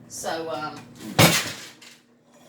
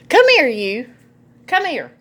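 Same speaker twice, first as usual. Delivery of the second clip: affectionate call; 8 semitones higher, 6 dB louder than usual. Real, not acted. Grandma calling her grandson into the kitchen, loud and high. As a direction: call him over eagerly.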